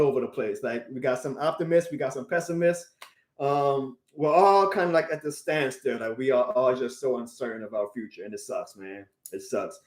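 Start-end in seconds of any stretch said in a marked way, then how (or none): 6.3-9.8 low, much softer, deflated; dejected. Real, not acted. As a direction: let it sink, almost gone. Fan despairing over team's future.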